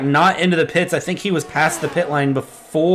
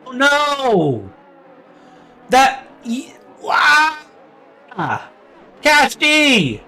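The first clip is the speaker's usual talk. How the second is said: high, pained cry of dismay; real anguished outburst; 7 semitones higher, much slower than usual. Real, not acted. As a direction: groan out as the race slips away. Driver's race suddenly ruined.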